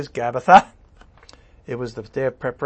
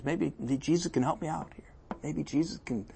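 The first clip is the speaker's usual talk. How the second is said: hushed musing; 12 dB quieter than usual. Acted. Pilate muses hopefully, hushed.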